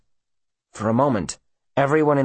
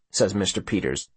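ordinary delivery

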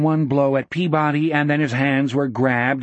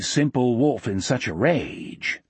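usual speaking voice

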